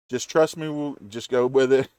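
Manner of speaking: ordinary talk, nothing marked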